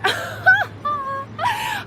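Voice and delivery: very high, squealing laugh; big amused laugh. Real, not acted. Realizing she resembles her mother, high laughing burst.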